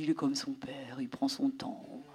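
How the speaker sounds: chuchoté, lent, pour soi; whisper. Retold or imitated. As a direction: murmure une pensée rassurante. Pensée intérieure chuchotée. (pensée chuchotée lentement)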